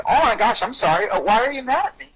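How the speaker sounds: high-pitched, lightly startled apology; mock apologetic surprise. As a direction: act taken aback and sorry. Acts apologetic reply to angry person.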